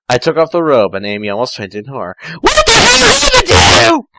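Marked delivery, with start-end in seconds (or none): 2.4-4.1 very high, loud horrified shriek; shrieking Amy. Acted. Amy seeing Tails transformed, high horrified shriek. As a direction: shriek it in horror. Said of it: "Amy shrieked".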